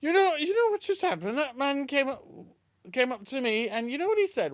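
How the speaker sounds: high, excited mock husband; hammed-up high voice. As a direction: squeal the news up high. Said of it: "and he says".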